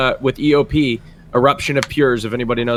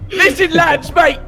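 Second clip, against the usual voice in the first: loud, high mock British lad shout; impression of Raj; 3 dB louder, slower than usual. Imitating Raj to the group in a loud, high British lad shout. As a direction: bellow like a rowdy London lad. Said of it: "I don't sound like that".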